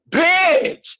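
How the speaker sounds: a sudden high yelp of delight; excited shout. Real, not acted. Telling the girls about the fart, an excited high yelp. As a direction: shriek it out in glee.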